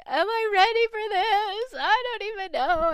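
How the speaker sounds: high, loud panicked self-talk; panicking. Retold or imitated. Alone upstairs before the breeder trip, panicking out loud. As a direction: let the voice climb up in panic. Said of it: "I'm just starting to panic".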